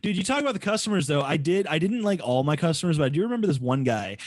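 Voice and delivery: ordinary delivery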